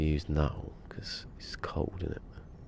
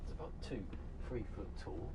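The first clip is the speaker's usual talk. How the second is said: distant and much softer; far off; 3 semitones higher, 16 dB quieter than usual. Real, not acted. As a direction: speak from well away from the mic. Talks while away from mic.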